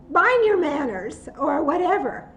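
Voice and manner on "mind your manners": retort. Pretend. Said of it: "tempting if somebody's rude to say".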